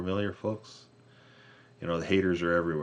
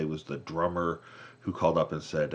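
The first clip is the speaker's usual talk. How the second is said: ordinary talk, nothing marked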